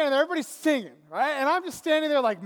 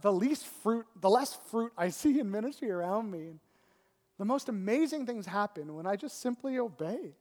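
usual speaking voice

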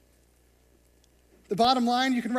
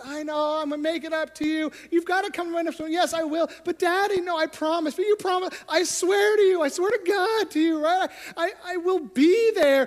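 pleading dialogue; 8 semitones higher, 6 dB louder than usual. Retold or imitated. Father and daughter pleading.

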